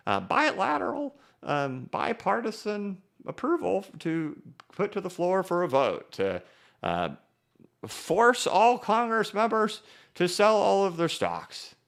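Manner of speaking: high, slow, wavering grandma voice; old grandma voice. Pretend. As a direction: put on a creaky old lady voice. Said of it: "You guys like my old grandma voice?".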